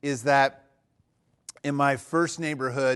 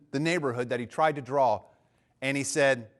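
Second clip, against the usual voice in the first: ordinary delivery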